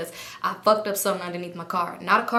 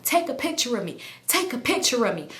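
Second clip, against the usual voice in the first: ordinary delivery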